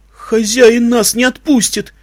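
high, slow protest; young colt's fearful objection; anxious young stallion. A narrator's reading. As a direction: drag it out high and worried. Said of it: "пробормотал какой-то молодой жеребец".

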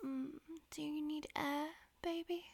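ordinary talk, nothing marked

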